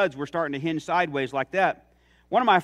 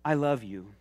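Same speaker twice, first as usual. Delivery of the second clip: gentle, softer loving plea; tender God; 3 semitones lower, 4 dB quieter than usual. Pretend. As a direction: call out gently as God at the heart's door. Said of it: "God knocking at your heart's door and saying".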